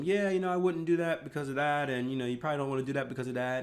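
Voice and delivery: high-pitched quick mock explanation; imitated explaining. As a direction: act out yourself patiently talking someone out of it. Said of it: "I'm trying to explain to them".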